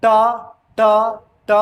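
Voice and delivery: ट repeated with the tongue curled to the palate; retroflex t drill. Shown as a demonstration. Teacher drilling the class on curled ट. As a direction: tap out ट several times, curled. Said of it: "जीभ ऐसे मुड़ता है".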